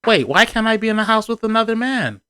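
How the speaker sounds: high-pitched mock protest; questioning. Wife challenging husband as a workman arrives, questioning.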